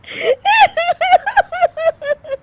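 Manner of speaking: very high, loud cackle; long helpless fit of shrieking laughter; uncontrollable laughter. Acted. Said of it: "Anshu फिर से हंसने लगती है". (ऊँची बेकाबू हँसी)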